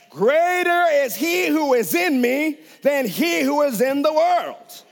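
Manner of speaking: a preacher speaking faith confessions aloud; bold confession. Pretend. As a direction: proclaim it with rising faith, slowly.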